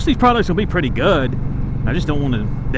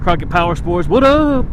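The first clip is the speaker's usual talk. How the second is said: shout-out; 4 semitones higher, 5 dB louder than usual. Real, not acted. Shout-out to dealership.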